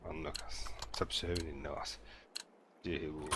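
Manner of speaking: hushed whisper. Real, not acted. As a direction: whisper it, sneaking. Sneaking in game, whispering.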